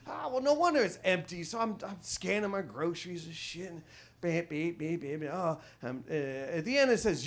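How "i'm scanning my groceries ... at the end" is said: ordinary delivery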